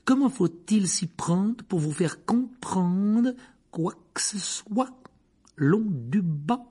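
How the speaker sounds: high, slow, contemptuous teacher voice; Snape sneering. Acted. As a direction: drag out every insult to the pupil. Rogue scolds Neville in class. (professeur méprisant, voix haute et lente)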